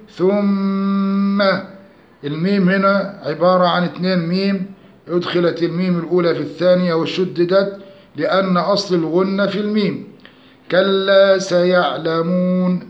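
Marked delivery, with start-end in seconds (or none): 0.1-1.4 ثم with a long hummed double m; doubled meem. Shown as a demonstration. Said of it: "هنا شددنا الميم". 10.7-12.9 slow chanted كلا سيعلمون, long final oo; measured tajweed chant, held oo in سيعلمون; madd recitation. Shown as a demonstration. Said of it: "مد عرض السكون".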